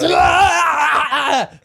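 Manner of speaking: sudden high loud yelp; startled yelp. Acted. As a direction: jump with a shout. Startled in character.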